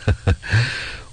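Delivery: short acted chuckle; a small, soft run of laughter; amused chuckle. A narrator's reading. Comrade joining in the good mood, amused chuckle. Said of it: "두 철주도 빙긋 웃었다".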